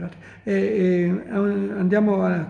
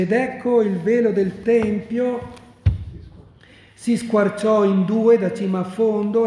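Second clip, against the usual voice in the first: raised, solemn Gospel reading aloud; scripture reading; 3 semitones higher, 4 dB louder than usual. Acted. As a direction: proclaim the verses to the congregation. Reads the Gospel passage aloud.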